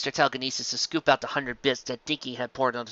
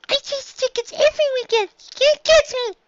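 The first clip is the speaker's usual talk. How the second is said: put-on high, teasing child voice; little filly voice; 5 dB louder than usual. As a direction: taunt like a cheeky little girl. Dinky's child voice taunting.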